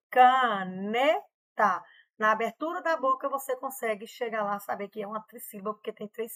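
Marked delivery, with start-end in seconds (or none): 0.1-1.8 "caneta" drawn out slowly as "ca-ne-ta"; "caneta" stretched into three slow beats; slow syllable split. Shown as a demonstration. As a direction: open the mouth on each syllable of "caneta". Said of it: "Quando é trissílaba, por exemplo".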